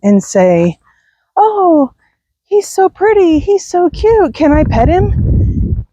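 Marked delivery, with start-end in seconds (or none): 1.4-5.9 high-pitched mock cooing over a dog; gushing passer-by. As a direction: imitate an admiring stranger, pitched way up. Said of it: "Anybody that tries to approach us and say".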